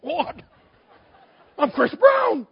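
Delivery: high, loud comic indignation; mock-outraged high shout; shocked outburst. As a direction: cry out in wounded disbelief. Acts out his shocked reply to wife.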